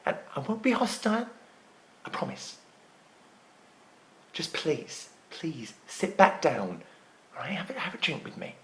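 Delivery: very soft, low, desperate begging; subdued, breath-held coaxing; hushed pleading. Acted. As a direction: beg gently, almost down to a whisper. Desperate plea to Kim to stay.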